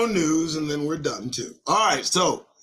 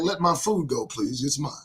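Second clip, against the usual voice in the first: a hint of a hawk, softer, pleading; mock hawk voice; 5 semitones lower, 4 dB quieter than usual. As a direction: play the hawk guarding its food, mildly. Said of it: "That, that sucker talked to me".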